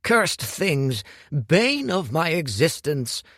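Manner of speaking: Hu rants to Dorian about tent poles; indignant rant. Acted. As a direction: curse the poles like an old nemesis.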